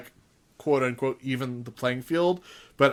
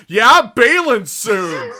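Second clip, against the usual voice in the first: eager quote; 6 semitones higher, 9 dB louder, slower than usual. Retold or imitated. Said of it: "Bobby Roode's not gonna immediately be like".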